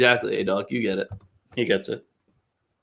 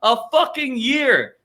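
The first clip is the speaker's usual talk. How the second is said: high furious shout at Messi's pay; angry rant; 11 semitones higher than usual. Real, not acted. Host rants about Messi robbing his club, furious shout. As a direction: yell it out, outraged.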